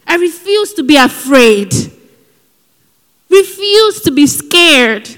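loud, emphatic refusal to fear; firm declaration. Real, not acted. Preacher declaring to the congregation, firm and loud. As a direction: declare it boldly.

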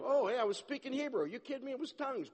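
high, gushing mock delight; excited impression. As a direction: act out a man thrilled with himself. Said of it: "He was more amazed".

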